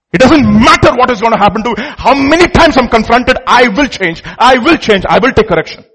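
loud, insistent declaration; forceful vow. Real, not acted. Preacher declaring he will take correction, loud and resolute. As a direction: drive each resolve home with force. Preacher vows to accept confrontation.

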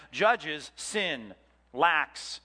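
usual speaking voice

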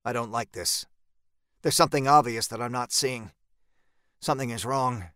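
plain reading voice

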